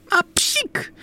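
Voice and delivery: high squeaky sneeze; a tiny high-pitched achoo. A narrator's reading. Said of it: "kichnęła gruba chochla".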